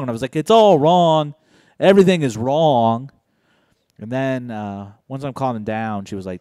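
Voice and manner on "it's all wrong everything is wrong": louder, higher wail of doom; hammed-up despairing wail; dramatic despair. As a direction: overblown tragedy, it's all ruined. Despair acted after accident.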